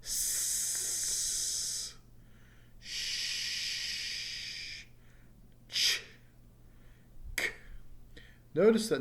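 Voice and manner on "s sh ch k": "S", "sh", "ch", "k" hissed out with no voice; teacher isolating unvoiced consonants; unvoiced sound demo. Shown as a demonstration. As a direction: sound each "S" "sh" "ch" "k" as a bare unvoiced noise. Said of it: "You can do the same thing for all the rest of these sounds".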